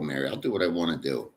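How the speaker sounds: ordinary talk, nothing marked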